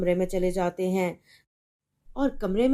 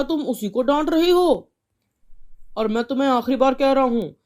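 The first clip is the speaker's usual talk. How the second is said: louder, fast, scolding his wife; a husband furious about the fine; angry father; 5 dB louder than usual. Acted. Father warns mother.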